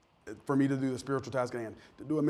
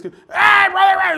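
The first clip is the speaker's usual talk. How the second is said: much higher and louder, slow hearty shout; booming pulpit greeting; 13 dB louder, slower than usual. Retold or imitated. Kent York fired up in the pulpit, big hearty shout. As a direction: throw the greeting to the back row. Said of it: "he gets up here and he's".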